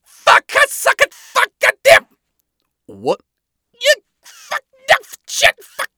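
high, loud frantic babbling; frantic shouting. Acted. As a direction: shout the words in a panic. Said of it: "a ludicrous barrage of words".

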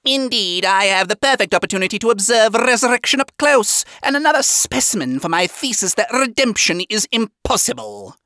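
eccentric, condescending scientist; high-pitched pompous eccentric; Baxter. A narrator's reading. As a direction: lecture down at them in a high, odd voice. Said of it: "said in an eccentric and condescending tone".

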